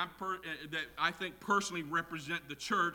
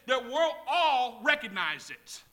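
loud, high, emphatic sermon delivery; preaching at full force; 8 semitones higher, 9 dB louder, slower than usual. Real, not acted. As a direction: drive each line home to the congregation, slowly on key words.

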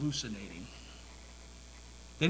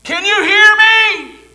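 very high, loud call; hollering; 22 dB louder, slower than usual. Retold or imitated. Rescuer calling a second time into the coal mine. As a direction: shout down the shaft. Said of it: "the voice ... said".

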